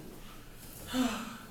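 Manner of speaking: soft, unvoiced, falling sigh; weary sigh. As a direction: exhale heavily. Tired man sighs before his story.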